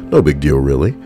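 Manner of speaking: usual speaking voice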